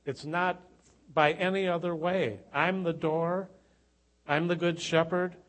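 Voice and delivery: loud, high-pitched fervent preaching; preaching at full force. Acted. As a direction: proclaim it to the congregation at full power.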